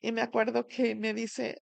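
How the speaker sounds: ordinary delivery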